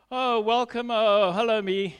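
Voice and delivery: loud mock-cheery hello; sarcastic greeting. Waking up sick of herself, loud sarcastic welcome. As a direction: greet yourself with heavy irony.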